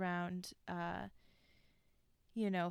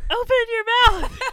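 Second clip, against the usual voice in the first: high, loud, laughing mock yell of the bishop; 15 dB louder than usual. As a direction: bark it like the angry bishop, cracking up. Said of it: "The bishop yelled".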